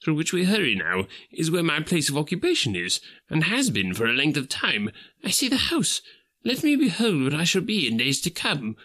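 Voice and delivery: put-on an old man begging to see his future; frightened old Scrooge asking the spirit; Scrooge, anxious. Scrooge pleads with the phantom.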